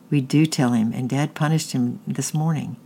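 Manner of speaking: usual speaking voice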